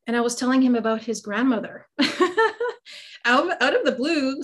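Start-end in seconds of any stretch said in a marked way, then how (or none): 1.9-3.2 high bursting laugh. Real, not acted. Laughs recalling the grocery store. 3.2-4.4 words spilling out through a laugh; higher, still laughing at herself. Real, not acted. Laughing while recalling the moment.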